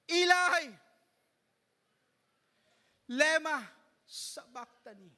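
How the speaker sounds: loud anguished cry that drops away at the end; loud cry fading. Acted. Preacher voicing Jesus's dying cry, loud then fading. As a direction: cry out, then let it die. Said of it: "with a loud voice".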